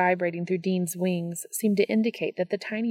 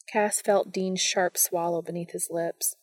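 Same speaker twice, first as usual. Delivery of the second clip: plain reading voice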